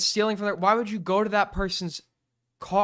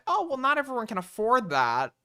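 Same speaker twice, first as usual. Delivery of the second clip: higher-pitched mock objection; mocked excuse; 5 semitones higher than usual. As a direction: put on a whiny objector's excuse, mockingly. Voices an excuse to rebut it.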